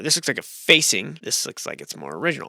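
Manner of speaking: ordinary talk, nothing marked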